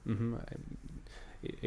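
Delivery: ordinary talk, nothing marked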